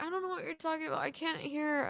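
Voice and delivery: high, soft groggy mother voice; half-asleep mom. Retold or imitated. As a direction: imitate a mom who won't wake up. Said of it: "I'm, like, half asleep".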